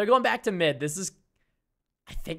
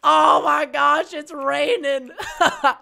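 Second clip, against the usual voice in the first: excited; 5 semitones higher, 6 dB louder, slower than usual. Acted. Gushing, raining.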